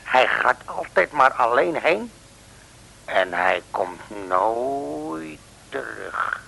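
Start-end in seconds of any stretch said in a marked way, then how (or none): 3.0-6.4 very slow, spooky, leaning on nooit; ominous slow line. Acted. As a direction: let the words hang like a mystery. Ominous tale of vanishing man. (heel langzaam en griezelig verteld)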